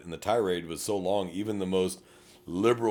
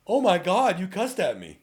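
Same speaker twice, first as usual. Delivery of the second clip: much higher, louder, offended gasp of shock; mock shocked whine; 11 semitones higher, 5 dB louder than usual. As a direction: play the stunned offended driver. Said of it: "they just are flabbergasted".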